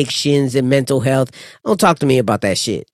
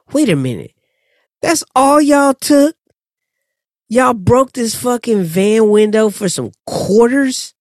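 incredulous outrage; 8 semitones higher, 3 dB louder than usual. Retold or imitated. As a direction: stretch it out, louder and higher, in disbelief. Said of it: "And I'm like".

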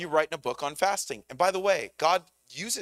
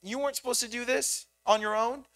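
high, gentle voice of God; God's reassuring voice; 9 semitones higher than usual. Retold or imitated. Preacher voicing God comforting a weary believer, high and gentle. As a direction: reassure the striving child tenderly. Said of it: "And he's like".

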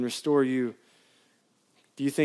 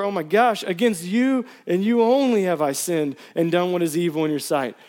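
pleading psalm read with rising intensity; impassioned scripture reading; 3 semitones higher than usual. Acted. Pastor reading a psalm to church, pleading. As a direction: preach the plea as your own. Preacher reads the psalm aloud.

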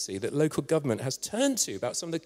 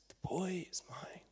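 slow, breath-soft whisper; hushed claim; 14 dB quieter, slower than usual. Acted. Rescuer with burnt hands claims the boy, whispering. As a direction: whisper it slowly and tenderly.

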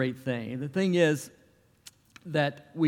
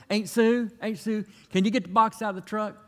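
put-on higher voice for a small boy calling out; child's voice; 6 semitones higher than usual. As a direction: play the little nephew pestering his aunt. Nephew's request voiced in story.

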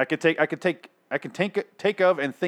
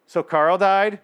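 higher, louder imitation of a child; little kid; 6 semitones higher, 6 dB louder, much slower than usual. Reviewer imitating kid on a bench asking about death, childlike. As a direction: mimic a kid asking, pitched up. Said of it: "hear a little kid on a bench go".

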